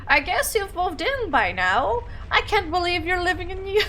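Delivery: high, lilting put-on mom voice; swoopy mock old mother; weird old-mother voice. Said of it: "that's a weird voice".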